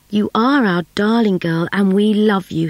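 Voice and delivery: plain reading voice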